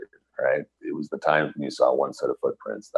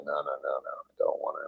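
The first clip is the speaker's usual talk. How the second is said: weak refusal; 8 dB quieter, faster than usual. Retold or imitated. Refusing rehab playlist, retold.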